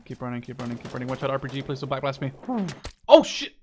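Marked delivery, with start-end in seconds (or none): 3.1-3.5 loud, high burst of alarm; startled yelp. Real, not acted. Gamer caught by a blast, startled yelp. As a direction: blurt it out in sudden shock. RPG fire near him.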